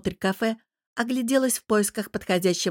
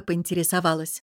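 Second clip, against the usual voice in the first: usual speaking voice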